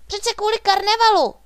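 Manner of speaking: very high, loud cry; excited high-pitched exclamation; exclaiming. A narrator's reading. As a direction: call it out high and full-voiced. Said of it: "zvolal Bonifác".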